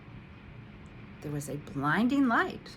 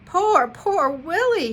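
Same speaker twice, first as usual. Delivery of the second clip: higher, louder, slow pleading pity; almost in tears; 10 semitones higher, 10 dB louder, slower than usual. A narrator's reading. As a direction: near tears over a friend's fate, drawn out. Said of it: "Alexander was almost in tears".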